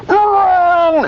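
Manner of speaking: very high, blaring cartoon alarm of a voice; little inner voice. Therapist acts out client's inner voice, shrieking high. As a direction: blurt it as one long, piercing held note. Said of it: "a little voice inside your head goes".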